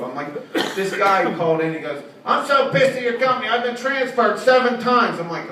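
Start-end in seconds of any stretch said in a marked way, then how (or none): 2.2-5.2 angry caller. Retold or imitated. Angry caller quoted by comedian.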